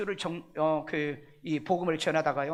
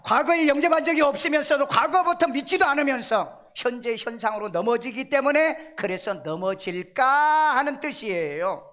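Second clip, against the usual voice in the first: forceful preaching; 7 semitones higher, 6 dB louder than usual. Real, not acted.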